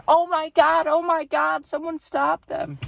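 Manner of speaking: high, frantic mock panic; shrill lady voice begging someone to intervene; panicked lady. A lady near the fight crying for someone to stop them, high and frantic. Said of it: "She's like".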